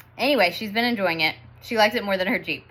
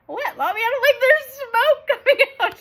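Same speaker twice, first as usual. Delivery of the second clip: put-on high, loud child's voice, laughing through it; little girl puzzled; 5 dB louder than usual. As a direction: play the daughter who can't see the smoke. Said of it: "she's like".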